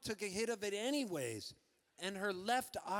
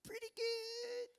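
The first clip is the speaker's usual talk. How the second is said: stoned mom; 5 semitones higher, 5 dB quieter than usual. Acted. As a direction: float it up high like a stoned mother.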